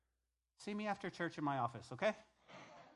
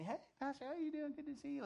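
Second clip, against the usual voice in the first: much higher, softer cheerful greeting; higher bright hello to the pastor; chirpy congregant; 10 semitones higher, 6 dB quieter than usual. Retold or imitated. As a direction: mimic a friendly churchgoer passing by. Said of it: "you'll come up and say".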